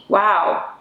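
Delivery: ordinary talk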